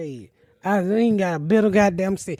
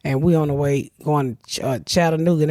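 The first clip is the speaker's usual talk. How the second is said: usual speaking voice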